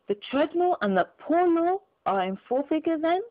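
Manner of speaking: a dry, mocking miser; Scrooge mocking.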